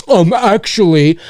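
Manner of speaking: slow, drawn-out nerdy correction; nerdy um actually. Retold or imitated. Mocking a commenter about to correct him, slow and smug. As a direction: play the smug pedant, stretched out. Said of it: "before they go".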